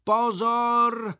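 high, loud, stretched warning cry; called out. A narrator's reading. Boy on the slope before jumping, calling out. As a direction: sing out a long warning. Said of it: "zvolal podruhé".

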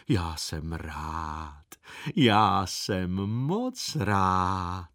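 very slow, low, drawn-out contentment; blissfully pleased elephant, vowels stretched long; delighted. A narrator's reading. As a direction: savour every word with deep pleasure. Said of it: "Jumbo se velice zaradoval".

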